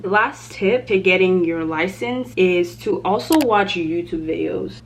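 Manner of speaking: ordinary talk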